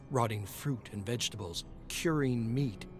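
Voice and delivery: plain reading voice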